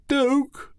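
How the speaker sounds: high sharp call of the name. Acted. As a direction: shout his name in alarm. Calling out to Duke.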